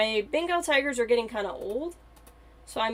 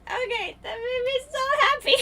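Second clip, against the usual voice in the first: much higher, louder squeals of joy; squealing with delight; 4 dB louder than usual. Real, not acted. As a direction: squeal like you just saw the cutest thing ever. Still overjoyed about the baby.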